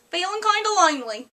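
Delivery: high, drawn Australian accent. Shown as a demonstration. Vlogger repeats the second phrase for viewers in high Australian accent. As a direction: repeat it in high-pitched Aussie. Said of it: "say with an Australian accent".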